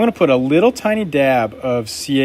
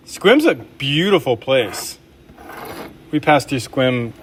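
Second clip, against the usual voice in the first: usual speaking voice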